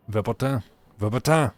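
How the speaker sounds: put-on German word with French-accented, open final syllable; terrible German, French accent. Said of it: "Terrible German, but in French".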